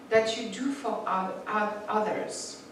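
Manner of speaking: ordinary talk, nothing marked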